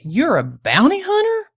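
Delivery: high, loud incredulous question; taken aback, rising sharply at the end; astonished. A narrator's reading. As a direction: let the pitch soar in disbelief. Prospector astonished by her occupation.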